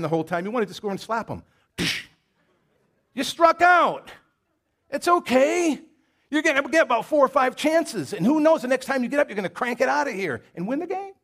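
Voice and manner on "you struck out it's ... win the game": loud, much higher cheering encouragement; booming encouragement from the dugout; coach's pep talk. Acted. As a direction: yell it like a coach picking up his batter. Pep talk to struck-out batter.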